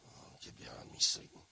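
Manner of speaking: tense whispered rebuke. Retold or imitated. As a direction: hiss it under your breath to resist temptation. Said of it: "I'm like".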